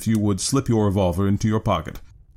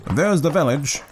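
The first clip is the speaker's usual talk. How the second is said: higher-pitched obliging coachman; a hint of a helpful cab driver, pitched up; lightly voiced driver; 5 semitones higher than usual. A narrator's reading. Driver answering his passenger about the house, lightly voiced and higher. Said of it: "said the driver".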